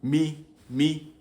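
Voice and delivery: "Me, me" on a high level tone. Shown as a demonstration. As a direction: hold both "me" syllables up. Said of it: "that's the me intonation mark".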